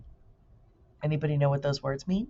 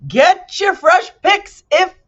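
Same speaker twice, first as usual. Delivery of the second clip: high, slow market hawker bellow; booming street vendor; loud merchant call; 13 semitones higher, 12 dB louder, slower than usual. Acted. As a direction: cry out your wares to the crowd. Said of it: "merchants' voices loudly advertising".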